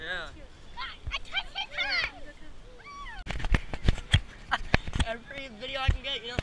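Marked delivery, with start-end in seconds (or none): 0.3-5.0 loud high burst of laughter; cracking up loud and high; big laugh. Real, not acted. Laughing hard at the silly goat.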